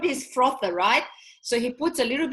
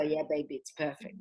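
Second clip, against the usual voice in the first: tender; 6 semitones lower, 7 dB quieter than usual. Retold or imitated. Mom tender reply.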